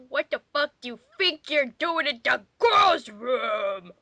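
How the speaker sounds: usual speaking voice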